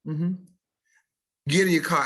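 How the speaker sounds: ordinary delivery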